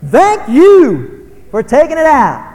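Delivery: slow, high, loud parental call. Acted. Parent calling to kid taking out garbage, loud and pointed. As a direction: holler it after the kid, drawn out.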